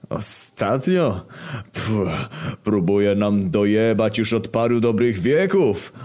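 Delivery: lightly cheerful, drawn out; a touch of glee. Acted. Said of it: "odpowiedział radośnie śmierć". (radośnie, z przeciągnięciem)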